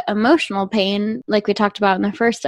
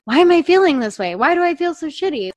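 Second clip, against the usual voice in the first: frustrated self-talk; 7 semitones higher, 4 dB louder than usual. Retold or imitated. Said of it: "much more compassionate than".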